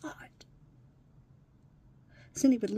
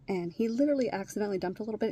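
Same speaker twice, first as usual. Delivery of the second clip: usual speaking voice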